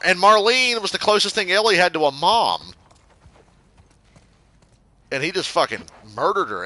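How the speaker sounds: shouting defence of the show; heated rant. Real, not acted. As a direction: tear into the complainers. Ranting at show complainers.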